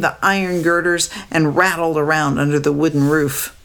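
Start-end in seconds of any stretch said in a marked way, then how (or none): none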